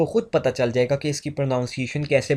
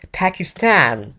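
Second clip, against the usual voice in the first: imitated English accent, puffed P, slow; 3 semitones higher, slower than usual. Shown as a demonstration. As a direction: stretch "पाकिस्तान" English-style, "Phakistan" with flat a. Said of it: "गोरे कैसे बोलते हैं?".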